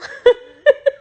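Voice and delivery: high, tearful laugh through emotion; teary laugh. Real, not acted. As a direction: laugh while about to cry. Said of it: "I'm so emotional".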